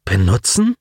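alarmed rising question; taken aback, sharp lift at the end; startled echo. A narrator's reading. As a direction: echo the word in shocked disbelief. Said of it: "Nun erschrak Colin".

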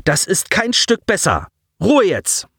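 höher, streng drohend. A narrator's reading. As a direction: Offizier weist das Mädchen drohend zurecht. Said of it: "wandte sich drohend an das junge Mädchen". (angedeutete Drohung)

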